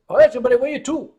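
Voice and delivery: put-on rattled off fast in a Newfoundland accent; imitated Newfoundland dispatcher repeating himself, fast. Dispatcher repeating to a lost tourist, fast Newfoundland accent. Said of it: "The Newfoundland accent is a bizarre combination".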